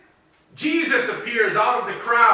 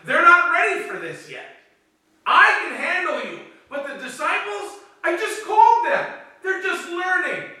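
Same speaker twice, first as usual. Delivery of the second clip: much higher-pitched, urgent defence; protective insistence; 9 semitones higher than usual. Acted. Jesus shielding his new disciples from Pharisees, protective insistence. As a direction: stand up for the novices, voice raised in pitch.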